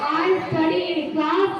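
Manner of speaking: slow, ending on a rise; slow model sentence. Shown as a demonstration. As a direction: leave it open for the class. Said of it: "वो बताना है". (धीरे बोलकर खाली जगह छोड़ना)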